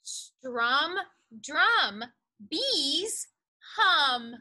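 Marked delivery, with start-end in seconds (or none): none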